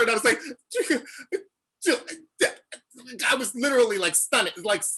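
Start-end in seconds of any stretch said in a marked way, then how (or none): 0.4-1.4 giddy laugh. Retold or imitated. Reacting to meeting offer.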